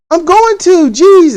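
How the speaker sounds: sulky daughter pushback; annoyed teen retort. Retold or imitated.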